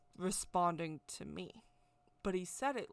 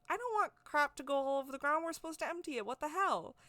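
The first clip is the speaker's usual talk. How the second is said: coworker's raised, fast, exasperated rant, higher; mad; 8 semitones higher, faster than usual. Retold or imitated. Coworker finding a filthy truck, an imitated angry higher rant. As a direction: mimic an upset coworker finding a dirty truck. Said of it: "he gets all mad".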